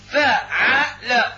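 فاعل broken slowly into fa, 'a, la; very slow, spaced syllables on فاعل; letter by letter. Shown as a demonstration. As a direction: spell out the pattern فاعل as three separate syllables, fa - 'a - la. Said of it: "الميزان الصرفي مكون من ثلاثة حروف".

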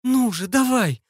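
high, drawn-out plea to push; slow, high-pitched egging on; urgent urging. A narrator's reading. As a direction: urge it on, straining and high. Straining to push the hatch.

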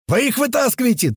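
high, urgent shouted command; yelled order. A narrator's reading. Туман to the narrator from the car, shouting orders. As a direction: shout the order across the noise. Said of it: "продолжал орать Туман".

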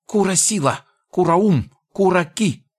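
high piercing goblin screech; a goblin shrieking in rage; shrieking furious goblin. Acted. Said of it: "в ярости пронзительно заверещал".

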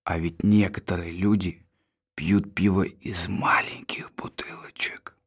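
low conspiratorial whisper; leaning in to whisper. Acted. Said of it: "Он понизил голос".

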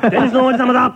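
laughing while claiming the title; a playful, laughing brag; laughing boast. Real, not acted. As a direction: chuckle through the boast. Joking boast between co-hosts.

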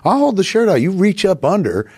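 high-pitched retold pleading instruction; flustered bargaining. Patient tells medic how to wire him up, flustered. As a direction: negotiate high and flustered, acting it out.

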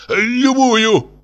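very high, tipsy wail; grief-stricken cry. A narrator's reading. Tipsy professor offers any manuscript, grief-stricken cry. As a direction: cry out in greatest despair. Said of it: "вскричал пьяненький профессор в величайшем горе".

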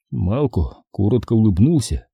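ordinary delivery

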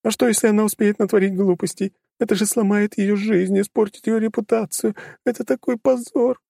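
high, tearful lamenting mother; wailing over the disgrace; lamenting daughter voice. A narrator's reading.

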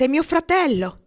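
high, loud cry of fear for her brother; anguished shout. A narrator's reading. Girl crying out that her brother is on the boat, frightened loud shout. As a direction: shout it out in panic. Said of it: "gridò la ragazza".

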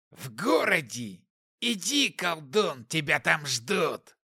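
slow, hoarse demonic taunt; mocking demon. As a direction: taunt the sorcerer slowly, hoarsely. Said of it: "демон хрипло рассмеялся".

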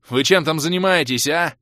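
high, loud demand; barked question. A narrator's reading. Fighter half-turns to check on mages, barking. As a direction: bark it over the noise of battle. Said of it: "рявкнул полуобернувшийся Крей".

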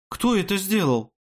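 high-pitched outraged question; indignant demand. A narrator's reading. Horrified maid demands the culprit. (возмущённый вопрос высоким голосом)

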